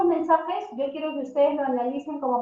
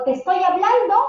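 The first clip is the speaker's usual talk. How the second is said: much higher and louder, an exasperated scold; scolding parent; 6 semitones higher, 8 dB louder than usual. Shown as a demonstration. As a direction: bark at a child who ignores you.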